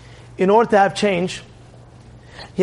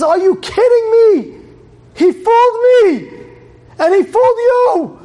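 high, loud disbelief; acted high-pitched indignant yell; outraged shout; 6 dB louder than usual. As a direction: cry it out, stunned at being fooled. Rosh Yeshiva realizes he was fooled.

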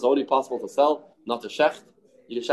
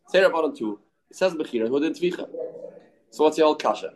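ordinary talk, nothing marked

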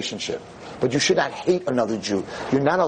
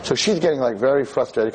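ordinary talk, nothing marked

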